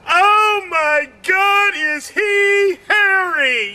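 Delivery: high, slow, shocked gasp of a line; mock shock. Comedian hamming up shock at a hairy man. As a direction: ham up the horror at a hairy man. Comic acting out shocked onlooker.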